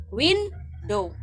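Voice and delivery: "Window" in two beats, WIN on top; accented syllable stress. Shown as a demonstration. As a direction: punch WIN in "Window", drop on dow. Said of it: "Dapat naa siya accent".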